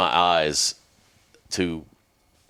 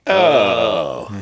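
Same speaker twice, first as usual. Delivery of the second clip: sudden realization; 6 dB louder than usual. Retold or imitated. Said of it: "And I was like".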